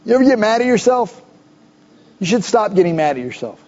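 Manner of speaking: usual speaking voice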